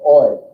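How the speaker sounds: "Oi" clear and firm; diphthong demo. Shown as a demonstration. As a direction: model "Oi" clearly. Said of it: "like an O Y sound".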